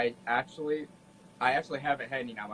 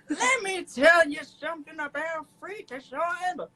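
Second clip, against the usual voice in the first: put-on old-timey voice. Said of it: "let me speak in my old-timey voice".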